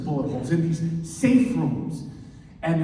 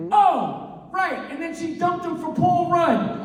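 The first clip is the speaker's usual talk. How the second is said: much higher, breezy dismissive voice; dismissive Phoebe; 12 semitones higher than usual. Acted. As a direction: brush him off for Paul Rudd.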